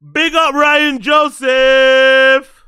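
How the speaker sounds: slow, loud drawn-out shout-out. Real, not acted. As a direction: call it out big and slow. Shout-out to a chatter.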